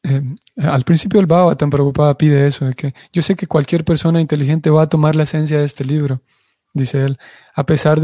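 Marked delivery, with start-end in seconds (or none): none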